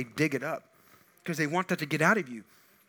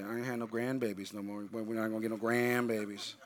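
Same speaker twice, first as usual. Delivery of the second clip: imitating mama; 6 semitones lower, 8 dB quieter than usual. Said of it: "your mama walks up to you and says".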